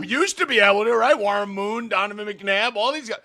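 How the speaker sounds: loud, high, exasperated rant; heated rant. Real, not acted. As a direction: vent at full volume. Heated rant about quarterbacks.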